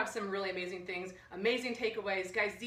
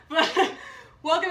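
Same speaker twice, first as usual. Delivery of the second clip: laughing; 6 semitones higher, 11 dB louder, slower than usual. Real, not acted. Laughing while welcoming.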